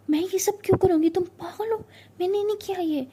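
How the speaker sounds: high-pitched, worked-up protest; indignant denial. Acted. Siya insists to Tara she didn't, high and indignant. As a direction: protest hotly, voice pitched right up.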